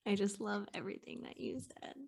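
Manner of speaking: breathy whispered awe, barely audible. Real, not acted. Guest whispering admiration to host. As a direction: hush it like a secret compliment. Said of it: "spontaneous hushed reaction to guest".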